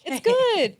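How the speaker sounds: high, loud through laughter; laughing, pitch shooting up; laughing reassurance. Real, not acted. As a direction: wave it off while cracking up. Laughing while friend waits to speak.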